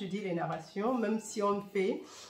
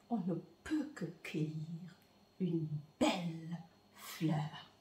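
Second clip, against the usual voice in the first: tender whisper; 4 semitones lower, 10 dB quieter than usual. Real, not acted. Tender image of one flower.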